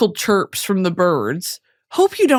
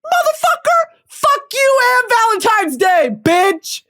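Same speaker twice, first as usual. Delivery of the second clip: much higher, squealed fury; mock outrage; 12 semitones higher than usual. Cursing the boyfriend and Valentine's Day, squealed. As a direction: screech it in comic rage. Furious comic rant.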